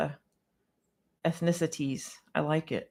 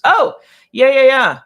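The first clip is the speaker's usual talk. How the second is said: high, loud knowing "oh yeah, yeah"; real excited recognition; eager agreement; 8 semitones higher, 12 dB louder than usual. Real, not acted. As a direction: jump in, fully relating. Reacts to viewer's frustration.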